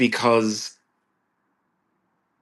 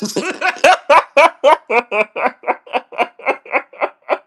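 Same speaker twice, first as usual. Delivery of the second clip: high laugh; 6 dB louder than usual. Real, not acted. Laughs at own joke.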